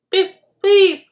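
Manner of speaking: high, loud whistle beeps; guard's whistle. A narrator's reading. Guard whistling the train away. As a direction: blow the whistle for departure. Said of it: "whistled the guard".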